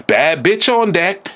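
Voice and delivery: high, slow campy proclamation; flamboyant announcement. Retold or imitated. Man imitating a flamboyant entrance, in a slow high campy voice. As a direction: announce yourself with diva swagger. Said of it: "If I came in all flamboyant".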